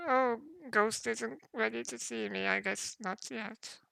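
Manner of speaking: put-on high, thin, quavering old dwarf woman; a frail old woman, startled in her room.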